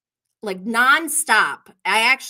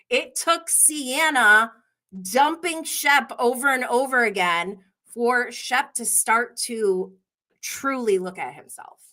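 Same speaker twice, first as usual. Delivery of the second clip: usual speaking voice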